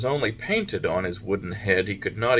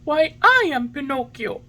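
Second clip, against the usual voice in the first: very high, louder puppet voice; excited; 5 dB louder than usual. A narrator's reading. Pinocchio jumps up and tells the pigeon who he is, excited. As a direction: announce it high and eagerly. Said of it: "jumping to his feet".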